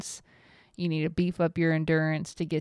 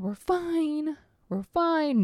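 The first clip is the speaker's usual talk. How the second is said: mock-calm higher "We're fine"; higher sing-song reassurance; 8 semitones higher, slower than usual. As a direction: chant "We're fine" higher, talking herself down. Repeating reassurance to herself.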